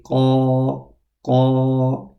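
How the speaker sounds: Qa thick, sound rising; loud heavy Qa. Shown as a demonstration. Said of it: "the direction of sound is upwards".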